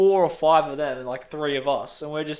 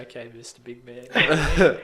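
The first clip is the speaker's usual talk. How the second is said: mocking sarcasm; 4 semitones lower, 4 dB louder, slower than usual. Mocking the bouncer.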